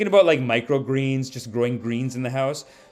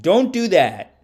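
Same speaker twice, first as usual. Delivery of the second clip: ordinary talk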